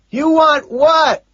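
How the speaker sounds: slow, very high yell; drawn-out shouted question; shouting back. A narrator's reading. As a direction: holler it as if you misheard. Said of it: "he shouts back".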